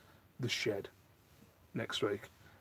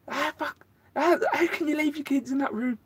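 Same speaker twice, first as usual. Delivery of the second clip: much higher, incredulous outburst; exasperated rant; 4 dB louder than usual. Retold or imitated. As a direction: squeak it out in disbelief. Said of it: "I was walking to the school today going".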